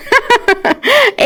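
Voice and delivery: high-pitched burst of laughter; acted high giggle; laughing. Friend startled, laughing in story.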